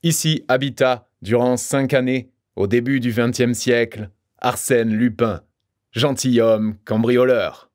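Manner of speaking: mock-solemn plaque announcement; solemn inscription. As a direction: read it out like a commemorative plaque. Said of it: "ainsi que l'on fait sur une plaque commémorative".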